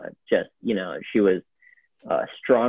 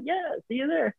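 much higher and softer, breezy; breezy reply to Brian, higher and softer; 6 dB quieter than usual. Retold or imitated. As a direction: toss it off lightly and quietly. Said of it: "I was like".

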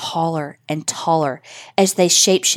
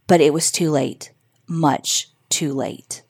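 ordinary delivery

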